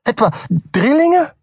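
very high, shocked squeak; panicked guess. A narrator's reading. Button blurts out a fearful guess, shocked and high. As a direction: gasp it out in alarm.

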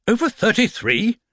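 high rising disbelieving echo; teacher stunned by the number; incredulous surprise. Acted. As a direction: repeat it as if mishearing. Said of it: "repeated the form teacher in surprise".

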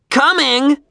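high shouted reply; calling back. A narrator's reading. Boy answering Shanice from the archway, high call.

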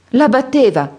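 grido alto e allarmato; esclamazione allarmata. A narrator's reading. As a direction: scatta in piedi sconvolta. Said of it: "esclamò la ragazza in lutto". (la vedova esclama sconvolta, alta e forte)

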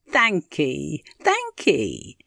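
lightly voiced old woman giving thanks; old country aunt. Aunt thanking Mary for the greeting, in character. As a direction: thank her in a country aunt's voice.

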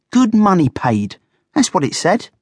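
high, insistent guinea pig; indignant squeaky protest; getting cross. A narrator's reading. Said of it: "beginning to feel quite cross".

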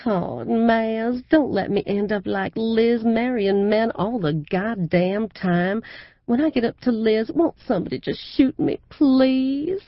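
high, loud Southern drawl, syrupy and showy; gushing southern belle. A narrator's reading. Mother showing off her fiancé to a crowd, in a high, loud Southern drawl. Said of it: "She also said".

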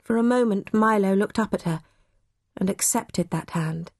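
plain reading voice

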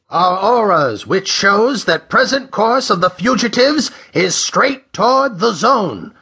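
high-pitched, crisp naval commander on the radio. A narrator's reading. Old commander radioing patrol ships about fugitives, crisp high voice. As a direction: pitch it high, like an official fleet transmission. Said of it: "a crisp, understandable voice".

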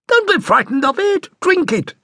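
high, coaxing mother's voice; mother urging her son to drink, high and coaxing. A narrator's reading. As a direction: egg the boy on in a high voice. Mother urges boy to drink.

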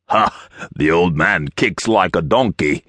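put-on growling giant with an accent; big henchman's growl; giant's growl. A narrator's reading. Giant examines his gashed hand, amused growl. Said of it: "growled the giant".

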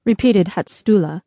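plain reading voice

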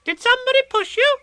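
put-on very high, bright child voice; eager tiny kangaroo voice; excited little Roo. A narrator's reading. Roo asking Eeyore eagerly, very high child voice. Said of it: "said Roo excitedly".

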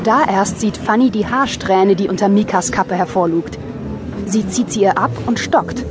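ordinary talk

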